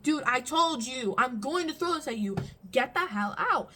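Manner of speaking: loud angry yell; yelling at the sister to get out; basically screaming. Retold or imitated. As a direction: shout her out of the room. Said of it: "And now I'm basically screaming".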